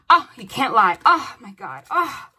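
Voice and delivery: thrilled the cards repeat; excited disbelief. Real, not acted. Cards confirm reading.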